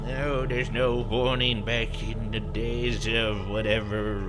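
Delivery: put-on slow old-timer grumble; crotchety old man grousing; grumbling old man. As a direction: grumble it out slowly, trailing into the last word. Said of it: "he, like, kinda grumbles".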